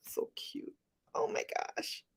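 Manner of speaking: whispered, doting; breathless gush. Real, not acted. Grandma doting on her baby grandson, whispered gush. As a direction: breathe it out, smitten.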